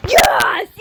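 loud high cheer of triumph; victory shout. Real, not acted. Gamer celebrating a win to viewers, loud shout. Winning the match.

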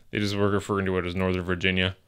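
ordinary talk, nothing marked

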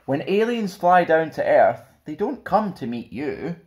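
plain reading voice

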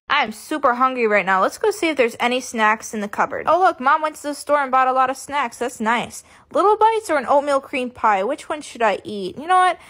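loud chatty vlog talk; loud vlog narration. Acted. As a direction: narrate the snack hunt to the camera. Loud solo snack vlog.

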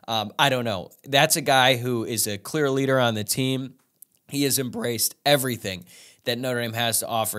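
usual speaking voice

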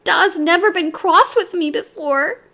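put-on high tearful child's plea; a hurt little voice, near tears; crying. A narrator's reading. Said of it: "Whoopsie was crying".

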